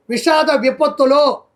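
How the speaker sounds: normal talking voice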